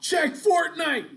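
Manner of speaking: high, loud, drawn-out defensive call; imitated team yell. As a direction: holler it across the field, slowly. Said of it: "and they would yell".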